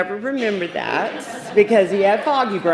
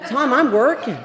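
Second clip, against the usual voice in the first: high, loud retold protest; exasperated retort; 7 semitones higher, 7 dB louder than usual. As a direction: tell him off, half laughing. Quoting herself protesting to Tom.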